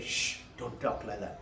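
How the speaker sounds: low, soft maternal shush; hushing. Retold or imitated. Mother to child about the monks, hushed reproof. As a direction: quietly hush a child who spoke out of turn.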